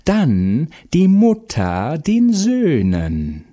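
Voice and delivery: high, drawn-out witch's cry; shrieking Baba Yaga. A narrator's reading. Baba Yaga confronts Vasilisa at the hut door, shrieking. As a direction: screech each phrase slowly, with swooping pitch. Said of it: "jetzt schrie sie".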